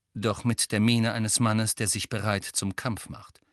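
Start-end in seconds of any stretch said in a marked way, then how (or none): none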